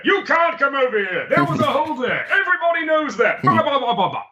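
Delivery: very high, mock-scolding host voice; Dark Room host. Shown as a demonstration. Game host rejecting the player's move, high theatrical voice. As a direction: pitch it way up, hectoring the player. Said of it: "Dark Room works like this".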